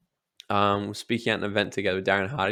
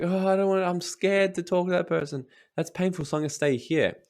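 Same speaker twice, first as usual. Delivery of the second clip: scared avoider; 7 semitones higher than usual. Pretend. Voicing a scared, avoidant person.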